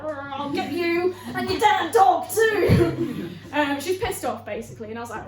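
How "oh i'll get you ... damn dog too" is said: high, loud, drawn-out mock witch threat; cartoon villain. Customer threatening theatre worker, hammed-up cartoon villain. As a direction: ham it up as a cackly cartoon villain. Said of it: "It's not a cartoon".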